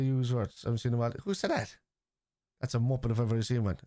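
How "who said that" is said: high-pitched, disbelieving question; incredulous. Real, not acted. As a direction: demand it in sheer disbelief, pitch jumping. Incredulous reaction to pundits' claim.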